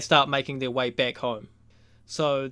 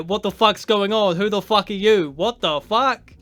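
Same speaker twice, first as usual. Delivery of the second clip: loud, higher retold outburst, shocked and furious; outraged yell; 5 semitones higher, 6 dB louder than usual. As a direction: bark it like a dad catching a stranger in the room. Joel yells at the intruder.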